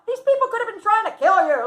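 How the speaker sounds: loud, high-pitched disbelief; alarmed scolding. Retold or imitated. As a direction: mimic people scolding a reckless friend. Voices people scolding her as insane.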